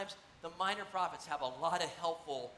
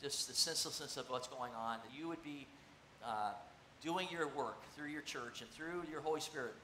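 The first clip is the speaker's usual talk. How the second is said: low, hushed prayer voice; 6 semitones lower, 6 dB quieter than usual. Acted. Pastor closing a prayer for the congregation, hushed and reverent. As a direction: pray it quietly and reverently.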